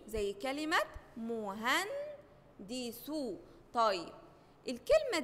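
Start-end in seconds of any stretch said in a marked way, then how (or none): 1.2-3.3 slow "مهندسو", broken into mu-han-di-su; syllable by syllable. Shown as a demonstration. Teacher repeats sukun example, "مهندسو" slowly split into syllables. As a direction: hold "هن", then place "دي" and "سو" separately. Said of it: "لازم حرف يشده معاه".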